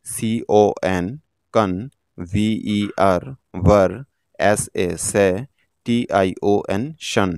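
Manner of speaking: slow, segmented teacher's demonstration; letters first, then the syllable, neatly separated; syllable-by-syllable spelling. Shown as a demonstration. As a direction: spell out each chunk, pause, then sound it. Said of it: "syllable অনুযায়ী ভাঙব".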